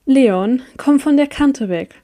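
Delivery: ordinary delivery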